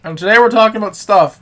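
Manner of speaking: voice crack. Real, not acted. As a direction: voice cracking mid-line. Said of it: "My fucking voice cracked".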